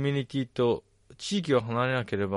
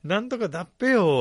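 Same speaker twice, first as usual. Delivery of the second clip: Ibaraki dialect imitation; 7 semitones higher, 5 dB louder than usual. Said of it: "は言う".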